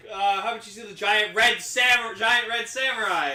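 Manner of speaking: loud mocking how-about retort; sarcastic retort.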